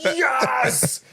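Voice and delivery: high, drawn-out imitation of a triumphant friend; gleeful cheering. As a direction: crow it high like a celebrating buddy. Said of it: "I heard him just go".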